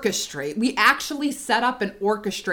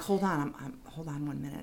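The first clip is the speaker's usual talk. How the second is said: much softer, offhand hold-on; hushed phone aside; 6 semitones lower, 12 dB quieter, faster than usual. Retold or imitated. Mom pausing her fake call to bait Rich, hushed. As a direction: drop the voice as if excusing oneself from a call.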